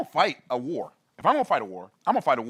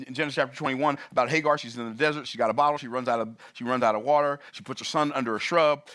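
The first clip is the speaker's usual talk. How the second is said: usual speaking voice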